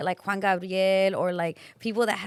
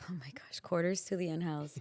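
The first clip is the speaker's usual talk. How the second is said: breathy whispered gasp; whispering; 3 semitones lower, 11 dB quieter than usual. Real, not acted. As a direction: whisper it in mock shock. Shocked reaction to dad joke.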